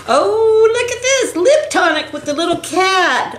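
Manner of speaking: very high delighted squeal; excited squeal. Real, not acted. Showing a cat lip tonic, excited squeal. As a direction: squeal as you show it off.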